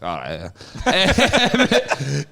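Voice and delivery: very drawn-out, backing-off "yeah"; suddenly calmed down. Retold or imitated. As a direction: imitate someone dropping back to zero, stretching it out. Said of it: "back to, like, zero".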